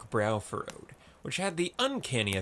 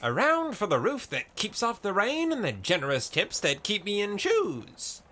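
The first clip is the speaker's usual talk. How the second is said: high-pitched, spirited toast; crying out; 9 semitones higher than usual. A narrator's reading. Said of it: "cried stubborn Kella".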